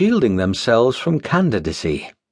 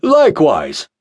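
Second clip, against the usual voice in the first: loud, self-important agreement; pompous pastor; 4 semitones higher, 4 dB louder than usual. Acted. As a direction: agree grandly. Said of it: "followed Pastor Collins".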